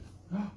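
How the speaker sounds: sharp high gasp of delight; high surprised intake of breath. Real, not acted. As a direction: gasp at the little dog. Spots the dog on camera.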